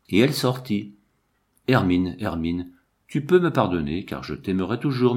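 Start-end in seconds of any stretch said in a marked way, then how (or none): none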